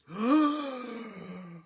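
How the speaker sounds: sharp high gasp of shock; amazed gasp. Shown as a demonstration. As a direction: gasp in wonder. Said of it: "we would have done something like this".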